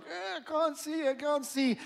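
high, soft whine of someone dazzled in bed; groggy birthday person. Acted. Preacher playing birthday person woken by the light, high feeble groan. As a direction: imitate a just-woken person squinting at the light. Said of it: "person in bed is like".

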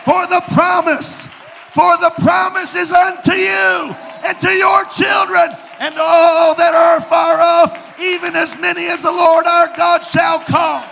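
loud, rising sermon delivery; fiery preaching. Acted. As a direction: build the scripture to a roar. Preaches Acts 2 at full force.

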